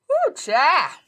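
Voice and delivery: high, loud exclamation after a big yawn; big yawny whoop; coming out of a yawn. Real, not acted. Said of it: "yawning dot com".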